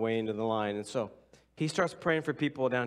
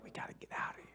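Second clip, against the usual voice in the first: urgent whisper to slip away; hushed and breathless, let's leave; whisper to friends; 13 dB quieter, faster than usual. Retold or imitated. Whispering to friends at the back door to leave.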